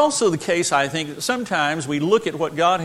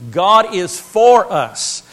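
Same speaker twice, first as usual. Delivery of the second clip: slow and much louder, hammering the words; louder emphatic repeat; 2 semitones higher, 7 dB louder, much slower than usual. Shown as a demonstration. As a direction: proclaim it, driving each word home. Repeats theme with force.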